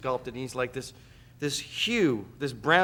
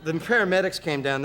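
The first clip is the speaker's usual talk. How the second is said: ordinary talk, nothing marked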